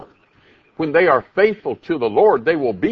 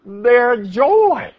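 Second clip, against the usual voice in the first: joyful; 12 semitones higher, 6 dB louder, much slower than usual. Real, not acted.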